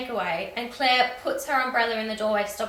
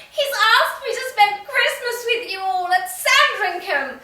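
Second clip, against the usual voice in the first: higher, louder, mock-thrilled announcement; thrilled news; 11 semitones higher, 8 dB louder than usual. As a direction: announce it like a dream come true. Claire shares royal invitation.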